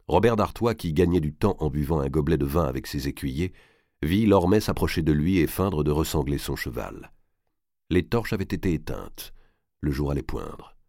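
ordinary delivery

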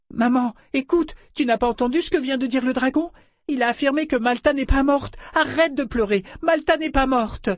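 put-on very high, urgent child voice; insistent little boy.

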